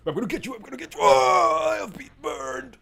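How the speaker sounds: very high, loud cartoon baddie shrieks; hammed-up villain threat turning to screams; Spyro enemy. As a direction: shriek like a burning game enemy. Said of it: "I would make a great Spyro enemy".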